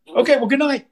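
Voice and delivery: high-pitched mock-casual good night; mimicked sudden retreat. Dad abruptly giving up after his son's stand, mimicked high-pitched goodnight. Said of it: "and he just went".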